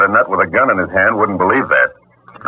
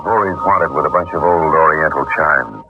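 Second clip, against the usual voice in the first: dry, wry noir narration over music; detective narration; 3 semitones lower than usual. Acted. Private eye narrating his case to the listener, dry noir storytelling. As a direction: tell it like a tired private eye looking back.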